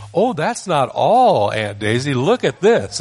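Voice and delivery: put-on high, loud, slow little child; three-year-old voice. Three-year-old showing his aunt the money, high child voice. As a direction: sound like a proud toddler. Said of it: "in my three-year-old voice".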